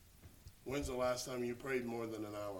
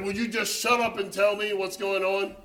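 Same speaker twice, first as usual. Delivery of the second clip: loud, higher, exasperated demand; impatient outburst; 7 semitones higher, 14 dB louder than usual. Retold or imitated. Impatient friend to the sobbing caller, loud exasperated demand. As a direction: snap at the crying caller. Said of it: "He said".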